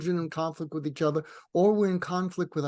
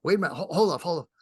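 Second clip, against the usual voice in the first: loud, higher, urgent cut-in; acted interruption; 3 semitones higher, 4 dB louder, much faster than usual. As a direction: halt someone who is yelling. Acting interruption of other person.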